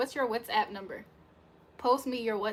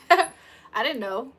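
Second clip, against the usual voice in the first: slow words through laughter; laughing; 3 semitones higher, 9 dB louder, much slower than usual. Real, not acted. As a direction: giggle it out, drawn out. Laughing about Canadian sorry.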